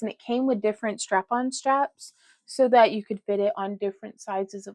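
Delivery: ordinary delivery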